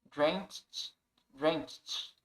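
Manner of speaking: "Drenched" as one syllable ending in T. Shown as a demonstration. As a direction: finish "drenched" on a light "cht". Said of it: "mas é um T aqui". ("drenched" com som de T no final)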